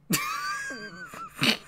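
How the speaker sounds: cartoon laugh. Button sound effect laugh.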